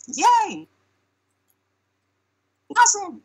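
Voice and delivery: very high-pitched imitated cheer; mock yell. Someone at the party calling for the voice, high mock yell. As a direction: yell it like a crowd member, way up high. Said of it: "Someone yells".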